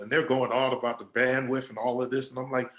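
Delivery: normal talking voice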